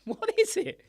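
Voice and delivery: high mock squeak. Real, not acted. Playful confusion over pronunciation.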